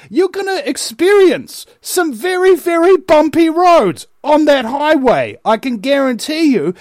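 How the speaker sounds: high, emphatic preacher-like warning; rousing oratory. Real, not acted. Commentator warning listeners of the hard road to truth, rousing. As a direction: raise the pitch and drive each phrase home.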